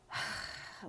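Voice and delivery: a weary here-we-go-again sigh; eye-roll sigh. Shown as a demonstration. Acting out the person's weary reaction, eye-roll sigh. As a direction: sigh like you're rolling your eyes. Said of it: "the eye roll and just a sigh".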